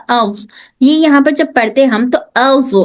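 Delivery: usual speaking voice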